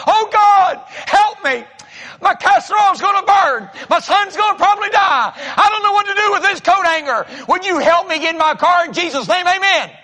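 high, loud, desperate pleading; frantic shouting prayer. Retold or imitated. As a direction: cry out to God in a panic.

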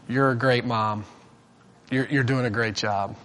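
low, soft, gentle compliment; softly spoken, pitched well down; gentle praise. Retold or imitated. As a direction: quietly quote a kindly stranger. Quotes the stranger praising wife.

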